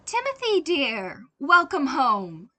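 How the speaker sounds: high, loud, effusive welcome; loud sing-song mother's welcome; gushing greeting. Acted. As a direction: throw the door open and gush. Mother greets son at door.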